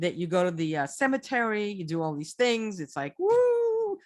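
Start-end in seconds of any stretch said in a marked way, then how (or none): 3.2-4.0 high, slow ghostly woo; a mock ghost hoot; spooky woo. Shown as a demonstration. Describing the ghost tour, slow high ghostly woo.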